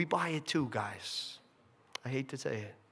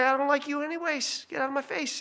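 a higher, dismissive mock Adam; Adam brushing off the serpent; 9 semitones higher than usual. Adam waves off the serpent, higher mock dismissal. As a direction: brush him off.

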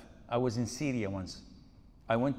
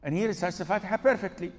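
ordinary talk, nothing marked

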